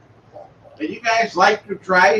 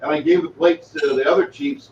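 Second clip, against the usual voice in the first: normal talking voice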